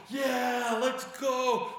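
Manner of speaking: high, loud rallying yell; Peter's battle cry. Retold or imitated. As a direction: whoop like a fighter raising his sword. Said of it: "with his little sword in the air go".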